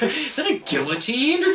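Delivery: high, delighted question through laughter; laughing mock wonder; amused mimicry. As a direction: echo it high, cracking up. Echoing excited quote while laughing.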